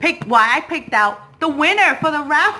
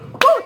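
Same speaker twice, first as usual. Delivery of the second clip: celebratory whoop; 13 semitones higher, 4 dB louder than usual. Real, not acted.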